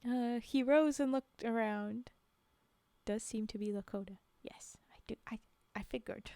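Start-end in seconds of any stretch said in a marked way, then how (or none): 4.4-6.3 whispering. Real, not acted. Quick aside to chat.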